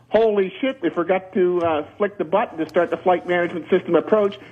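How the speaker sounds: ordinary talk, nothing marked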